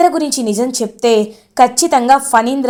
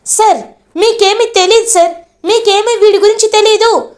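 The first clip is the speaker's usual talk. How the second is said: higher, urgent retold protest; agitated pleading; 9 semitones higher than usual. Vasudhara telling the elder sir he knows nothing, agitated. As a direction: push the point home, voice raised in pitch. Vasudhara confronts Phanindra angrily.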